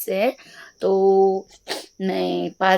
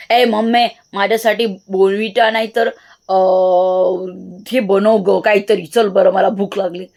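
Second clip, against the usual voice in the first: loud bossy Marathi demand to mom; आईकडे उद्धटपणे मोठ्याने मागणी; rude demand; 4 dB louder than usual. Shown as a demonstration. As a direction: bark it at mom like a spoiled kid. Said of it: "mummy आधी चार का नखरे देईल".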